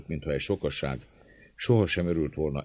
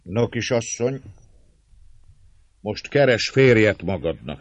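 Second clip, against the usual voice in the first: good-humoured, raised teasing call; cheerful; 6 semitones higher, 7 dB louder than usual. A narrator's reading. Márton teasing the young lady, cheerful jeer. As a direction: jolly taunt to a captive. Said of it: "szólt jókedvűen Márton".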